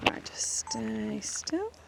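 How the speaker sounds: slow, hushed whisper. Real, not acted. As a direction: whisper it slowly, barely breathing. Creeping up on butterfly.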